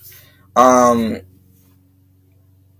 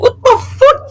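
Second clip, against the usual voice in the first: laughing disbelief. Retold or imitated. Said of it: "And I'm like".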